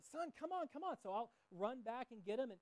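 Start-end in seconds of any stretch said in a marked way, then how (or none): 0.0-1.0 put-on high calling out to a lagging kid; calling son on. Dad calling lagging son to keep running, raised higher call. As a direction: coax him along from up ahead. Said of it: "So, so I'm run".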